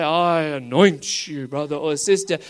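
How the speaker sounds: a hint of a grand preacher; pompous apostle. Apostle on pedestal anointing the crowd, mock solemn. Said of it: "apostle on the pedestal to come along and say".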